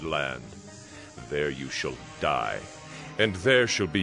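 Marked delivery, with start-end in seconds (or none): none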